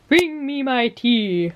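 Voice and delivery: slow, lofty, commanding and high; imperious plummy grande dame. Pretend. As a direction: order your servant about grandly. Ordering partner to bring tea.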